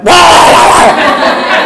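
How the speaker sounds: loud high barking shout; imitated dog lunge bark. Storyteller voicing the aggressive dog's bark, loud shout. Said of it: "And he went".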